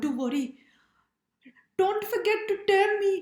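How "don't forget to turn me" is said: high, loud little-bread cry; high pleading cry of a worried chapati; Chapati's cry. Acted. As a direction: call out high and anxious like a tiny flatbread. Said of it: "cried Chapati".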